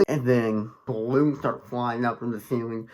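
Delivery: ordinary talk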